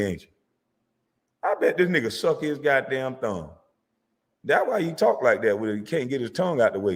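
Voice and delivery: usual speaking voice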